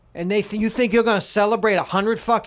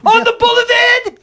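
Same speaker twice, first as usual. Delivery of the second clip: high, loud shriek in mock Australian; hammed-up Aussie-mocking squawk; outraged mocking echo; 8 dB louder than usual. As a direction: screech back their accent, incredulous. Shrieking back the Australian accent.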